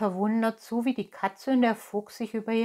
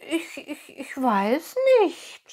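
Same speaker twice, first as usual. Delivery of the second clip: put-on high, slow, halting puppet boy; stammering; 7 semitones higher, 6 dB louder, slower than usual. A narrator's reading. Pinocchio answers the fairy's question, stuttering. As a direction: stammer uncertainly, high-pitched. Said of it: "stotterte Pinocchio".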